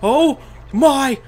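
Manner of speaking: loud, high victory shout; elated disbelief. Real, not acted. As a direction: shout in amazement at an unexpected win. Unexpected win.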